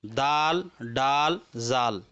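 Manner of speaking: दाल, डाल, जाल with long "aa"; maruf vowel. Shown as a demonstration. Teacher restates the accepted reading, दाल, डाल, जाल in maruf "aa". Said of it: "लिहाजा हम लोग इसको मारूफ पढ़ेंगे".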